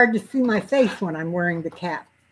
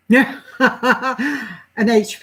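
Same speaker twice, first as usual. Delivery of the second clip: laughing; 5 semitones higher, 8 dB louder than usual. Real, not acted. Laughs while fumbling a name.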